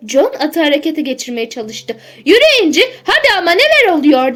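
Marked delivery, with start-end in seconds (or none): 2.2-4.3 high, loud urging call. A narrator's reading. Driver urging his stalled horse on, calling out. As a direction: call out to a horse that won't budge. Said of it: "diye seslendi".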